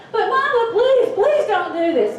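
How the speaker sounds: put-on high, loud, desperate kid begging laced with laughter; shrill childish plea, high and loud; pleading children. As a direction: beg like a terrified child, half laughing. Kids begging mother not to hang them.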